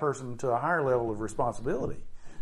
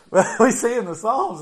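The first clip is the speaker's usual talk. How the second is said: laughing; 7 semitones higher, 8 dB louder than usual. Real, not acted. Preacher still laughing at the inmate story, louder.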